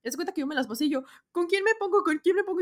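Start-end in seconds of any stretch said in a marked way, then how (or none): 1.3-2.6 high, loud hurried question; frantic asking. Retold or imitated. Fan rushing past guards asking which line, high and frantic. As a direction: rush it, looking for a line.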